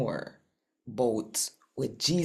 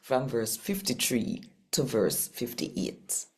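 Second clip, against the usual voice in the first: lightly voiced Jamaican scripture read; 3 semitones higher than usual. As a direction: tell the Bible story plainly in patois.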